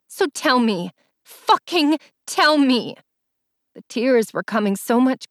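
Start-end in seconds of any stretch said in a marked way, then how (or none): 0.1-3.0 insistent demand, slowing and rising higher; angry demand. A narrator's reading. Allie presses Jace for the truth, angry demand. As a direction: push harder on the second demand, drawn out.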